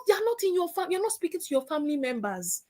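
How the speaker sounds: ordinary talk, nothing marked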